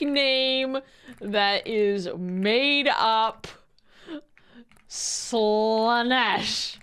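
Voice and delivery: very slow, stretched-out words; typing aloud. Real, not acted. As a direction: draw out each word as you type it.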